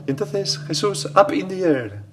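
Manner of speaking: put-on high, loud little-girl voice; little American girl. Priest quoting a little girl explaining the Assumption, high child voice. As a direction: imitate a small girl telling it excitedly, pitched right up. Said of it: "Recuerdo una niña graciosa americana".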